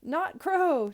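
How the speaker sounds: high, loud gleeful call. A narrator's reading. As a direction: call out to a bird. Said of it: "I called to the tiny bird".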